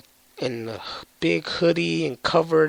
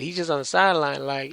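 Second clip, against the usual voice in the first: ordinary talk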